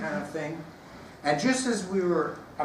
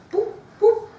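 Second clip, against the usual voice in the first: popping sound; 8 dB louder than usual. Retold or imitated. Putting in earplugs.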